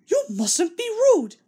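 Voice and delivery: very high, prim scolding; scolding coaches. Acted. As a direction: wag a finger at the cheeky engine. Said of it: "they kept telling Thomas".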